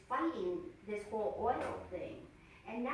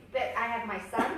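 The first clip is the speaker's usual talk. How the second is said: normal talking voice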